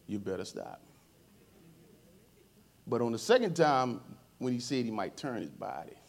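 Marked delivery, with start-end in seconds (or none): 0.1-0.8 soft, low repeated warning. Retold or imitated. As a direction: imitate a reserved man's quiet caution. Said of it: "you're gonna say it low".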